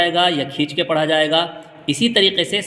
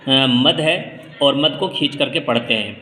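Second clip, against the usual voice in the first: ordinary talk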